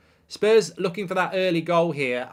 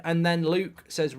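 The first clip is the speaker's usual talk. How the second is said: ordinary talk, nothing marked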